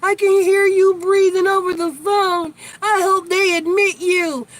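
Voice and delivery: high, mocking grandma voice quoting her. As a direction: mimic grandma's fake concern in a high voice. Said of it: "Talking about".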